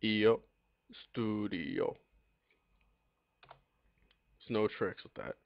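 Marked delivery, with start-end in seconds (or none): 0.0-2.0 "Io", a pause, then a stretched "studio"; sounded out slowly. Shown as a demonstration. Teacher modelling "io studio" for learners, slowly sounded out. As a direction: draw out "studio" syllable by syllable, as written. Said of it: "Pronounce it as you see it".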